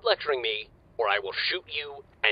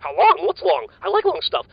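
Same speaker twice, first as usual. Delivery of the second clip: hyper rambling; 7 dB louder than usual. Acted. Speed-crazed rambling.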